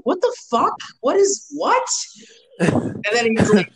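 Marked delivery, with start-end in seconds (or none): 0.0-1.9 high-pitched, retold bewilderment; baffled outburst. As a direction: act out total confusion, pitch jumping up. Acting out his baffled reaction.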